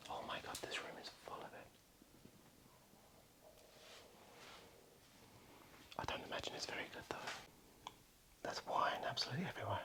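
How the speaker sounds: hushed whisper, sneaking around; breathy secret whisper. Real, not acted.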